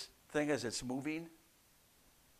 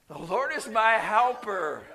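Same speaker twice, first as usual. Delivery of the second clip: raised, louder declaration; bold, confident; 8 semitones higher, 9 dB louder than usual. Shown as a demonstration. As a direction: lead the congregation in declaring it boldly. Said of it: "confidently and boldly say".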